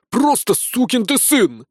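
high, slow furious roar; roaring corporal. Acted. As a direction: bellow it at the recruit. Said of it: "взревел капрал".

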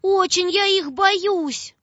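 put-on high, breathless scared girl; a frightened child telling her father; frightened calling. A narrator's reading. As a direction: blurt it all to papa in fright. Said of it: "кричит".

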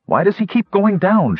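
high-pitched, urgent demand. A narrator's reading. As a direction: demand an answer, frantic for a reason. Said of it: "she demanded".

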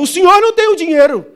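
loud and high, calling across the crowd; shouted retort. Acted. Auctioneer shouting back at the Englishman in the crowd. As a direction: fire it back at the bidder.

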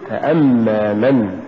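فأما slow, ف set apart and hamza pushed; push after fa. Shown as a demonstration. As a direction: lean on أما and draw out فأما. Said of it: "قل". (نطق فأما ببطء مع دفع الهمزة)

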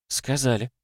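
high, soft and playful; sly. A narrator's reading. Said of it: "лукаво отозвалась его собеседница".

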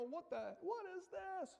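high mock-frightened voice; cowering in fear. Fishermen cowering in the boat see a figure, mock fear. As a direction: squeak it like someone cowering. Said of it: "they're cowering in fear".

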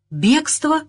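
high, amazed one-word question; astonished. A narrator's reading. Professor astonished at word of the escape, high surprise. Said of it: "изумился профессор".

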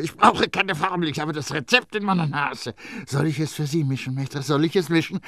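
high, eager pressed voice, quick; eager Grenouille. A narrator's reading. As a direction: push the offer on the master. Grenouille offers to mix.

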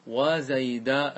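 "وزيدا" slow with a long final aa; alif ending. Shown as a demonstration. Teacher swapping the ending for alif, long aa on "وزيدا". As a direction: stretch the last vowel of "وزيدا". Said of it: "بالألف في آخره".

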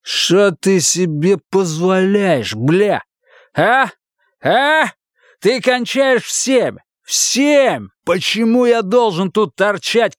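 high, furious male tirade, slow and punched; angry berating. A narrator's reading. Husband scolding his wife for being late, angry. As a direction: lay into her, drawing out the accusations.

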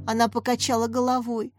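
ordinary delivery

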